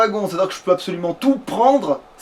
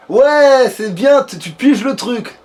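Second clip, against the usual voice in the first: loud, raised, drawn-out cheer; mock cheering; 4 semitones higher, 5 dB louder, much slower than usual. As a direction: whoop in exaggerated approval. Cheering companion who grasps trick.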